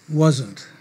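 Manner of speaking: ordinary talk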